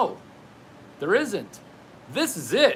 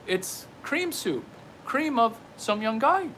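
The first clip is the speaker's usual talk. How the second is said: lightly put-on Chinese accent, higher; a hint of a Chinese accent, pitched up; half-hearted Chinese accent joke voice; mock Chinese accent; 5 semitones higher than usual. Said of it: "throw in the chinky accent".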